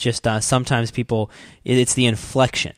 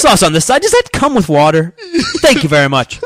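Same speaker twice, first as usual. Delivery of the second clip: loud radio-host impression ordering dinner; mocking radio voice; 7 semitones higher, 7 dB louder than usual. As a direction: project every line as if broadcasting. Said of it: "it's his voice".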